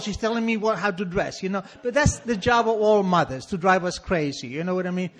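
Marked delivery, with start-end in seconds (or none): none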